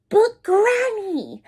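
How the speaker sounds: usual speaking voice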